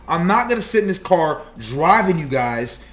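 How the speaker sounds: loud, high, fed-up rant at the couple; pulled over, friend yells at the arguing couple, fed up and loud; angry friend. Retold or imitated. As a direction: yell at them from the driver's seat. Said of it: "he pulled over and he was like".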